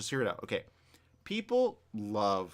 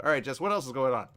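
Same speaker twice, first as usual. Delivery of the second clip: ordinary talk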